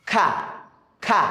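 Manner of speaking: খা clearly sounded for learners; drilled syllable. Shown as a demonstration. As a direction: sound out খা like a teacher. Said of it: "দেখেন এই যে কা".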